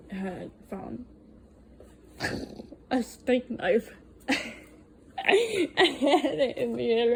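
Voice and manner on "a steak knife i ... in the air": laughing. Real, not acted. Laughing while telling of the fight.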